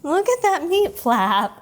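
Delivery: slow, high cooing; cooing at dog. Real, not acted. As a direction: coo at the dog.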